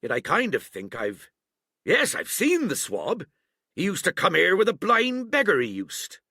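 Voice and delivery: high, loud musing aloud; aloud again. A narrator's reading. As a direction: speak up for the room to hear. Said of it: "he ran on again aloud".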